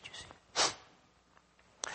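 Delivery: sudden hushed whisper to the congregation. Real, not acted. Preacher to his congregation about the Holy Spirit, whispered. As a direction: drop it to a breath, almost nothing.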